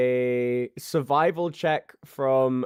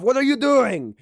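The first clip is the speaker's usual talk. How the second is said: high, loud chef scolding a cook; angry chef; 8 semitones higher, 6 dB louder than usual. Acted. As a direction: bark at the cook like a furious head chef. GM voices angry head chef.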